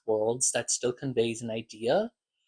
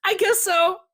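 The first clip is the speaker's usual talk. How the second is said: much higher, louder squeaky evasive voice; Celia's evasive reply; 6 dB louder than usual. Retold or imitated. Celia dodging Georgie's question, squeaky and evasive. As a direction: squeak it out like a caught liar. Celia caught in pajamas lie.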